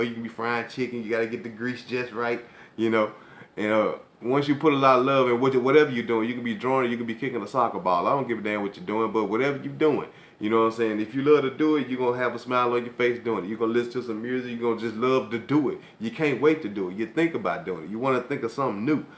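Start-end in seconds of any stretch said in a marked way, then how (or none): none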